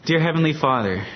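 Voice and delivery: ordinary talk, nothing marked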